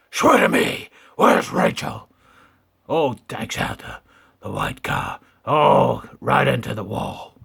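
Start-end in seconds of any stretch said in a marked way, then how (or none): 0.1-2.1 loud gravelly Batman demand; Batman shout. Pretend. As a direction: bark it like an angry Batman. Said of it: "Batman voice".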